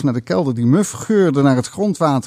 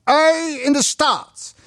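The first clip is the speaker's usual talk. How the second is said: high, very slow thundering dialect; 12 semitones higher, much slower than usual. Acted. Uncle thundering at the boy about the onion, in dialect. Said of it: "donderde hij".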